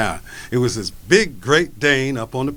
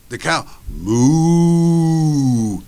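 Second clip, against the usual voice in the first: long, slow drawn-out moo; cow moo imitation; 2 semitones higher, 3 dB louder, much slower than usual. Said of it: "Take the cow".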